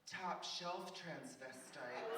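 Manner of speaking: very drawn out, savoring each word; slow, stately declaration; slow grand claim. Retold or imitated. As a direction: stretch it out slowly, as Tammy would. Tammy's grand claim at the counter.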